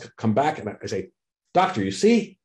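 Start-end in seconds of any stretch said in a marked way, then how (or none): 1.5-2.4 lightly acted triumph, pitched high and rising; eager young clinician. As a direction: play a junior proudly proving a point. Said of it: "as an eager to please, um, ambitious young clinician".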